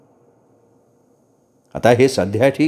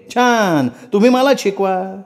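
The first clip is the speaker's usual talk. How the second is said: much higher pitched, ranting; shrill angry tirade; 7 semitones higher than usual. A narrator's reading. Wife screaming at husband about his mother, high and furious. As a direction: hurl every grievance at him, pitch climbing. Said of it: "आक्रस्ताळीपणानं आरडाओरडा करायला लागल्याबरोबर".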